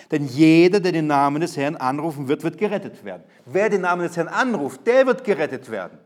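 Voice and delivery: loud opening on Denn jeder, then a proclaiming verse read; preaching. Acted. Reads and repeats scripture loudly. (laut verkündigend predigen)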